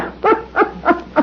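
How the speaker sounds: quiet high cackle of disbelief; a breathy, incredulous laugh; soft high laugh. Acted. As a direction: laugh at the silly name. Abner laughs at turkey named Chester.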